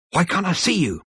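high-pitched, rattled question; urgent, pitched well up; agitated demand. A narrator's reading. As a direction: demand it of someone invisible. Zaphod shouts at unseen voice.

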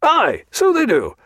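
a blustering admiral full of bonhomie; hearty admiral greeting the captain; bluff hearty Admiral. A narrator's reading.

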